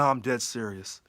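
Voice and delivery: ordinary talk, nothing marked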